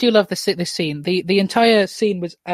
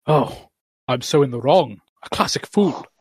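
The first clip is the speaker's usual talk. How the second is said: lightly voiced contrite husband, starting lower; second character voice; 4 semitones lower than usual. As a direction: confess as the film husband. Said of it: "do two different voices for this scene".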